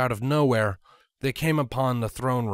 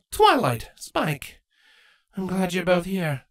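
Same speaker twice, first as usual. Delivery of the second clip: a raised queen voice with forced warmth; lightly voiced queen, strained smile; Queen Chrysalis; 8 semitones higher than usual. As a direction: greet them, hiding anger.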